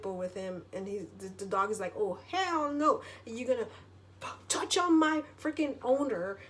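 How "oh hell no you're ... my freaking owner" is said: indignant protective dog. Retold or imitated. Pit bull to the homeless attacker, indignant. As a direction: put on a sassy warning, high-pitched. Voices dog defending its owner.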